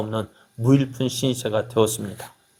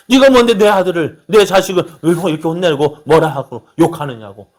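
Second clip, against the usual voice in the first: higher, louder indignant challenge; indignant parent; 4 semitones higher, 6 dB louder than usual. Retold or imitated. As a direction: snap back like an offended parent. 부모의 분노 흉내.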